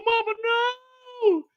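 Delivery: high drawn-out wail. Acted. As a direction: wail it in grief. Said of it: "Kane is like".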